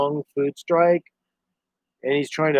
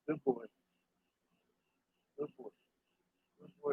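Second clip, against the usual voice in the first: murmured, much softer praise to the dog; 18 dB quieter than usual. Real, not acted. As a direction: coo it gently at your dog. Praising his dog softly.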